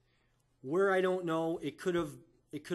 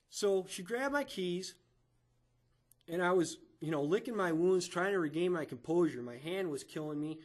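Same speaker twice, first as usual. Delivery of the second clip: ordinary talk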